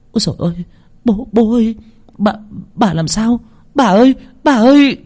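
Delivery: put-on high-pitched girl calling out in alarm; panicked. A narrator's reading. Girl rushing to her fallen grandmother, panicked. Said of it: "cái Tình hoảng hốt lao đến".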